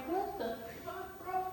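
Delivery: imitating herself, creeped-out and baffled; incredulous. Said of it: "I was just like".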